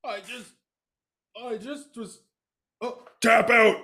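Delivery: choked high strained voice; in a headlock. Acted. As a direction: squeak out while held in a headlock. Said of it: "I got him in a headlock here".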